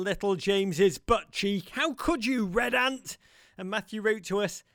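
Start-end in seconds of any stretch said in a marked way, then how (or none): 1.7-3.2 higher, hammed-up indignant cry; mock outrage. Host scolds the red ant that bit James, mock outraged. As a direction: scold the ant with theatrical betrayal.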